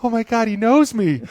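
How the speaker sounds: high delighted gush; starstruck squeal. Retold or imitated. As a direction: gasp it out like a fan. Starstruck retold thought.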